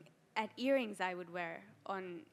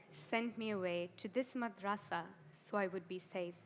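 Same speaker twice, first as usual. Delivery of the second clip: ordinary talk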